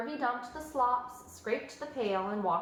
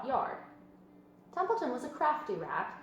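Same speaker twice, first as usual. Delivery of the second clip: plain reading voice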